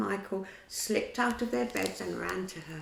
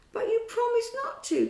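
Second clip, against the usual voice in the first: much higher, hurt outburst; upset cry; 6 dB louder than usual. A narrator's reading. Peter crying out in hurt at grown-up Wendy. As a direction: protest like a wounded child. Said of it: "cried Peter".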